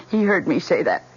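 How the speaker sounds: usual speaking voice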